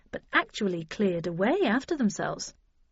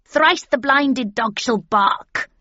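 slow, louder crone chant; witch voice; 4 semitones higher, 6 dB louder, slower than usual. A narrator's reading. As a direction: intone it like an old witch. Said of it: "said the first witch".

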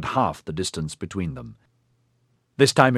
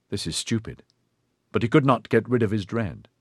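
usual speaking voice

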